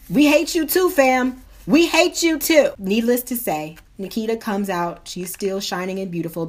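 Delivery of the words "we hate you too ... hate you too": higher-pitched emphatic retort; mock defiance. Couple griping about the rude tow lady, mock defiance. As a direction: throw it back, pitched up and punchy.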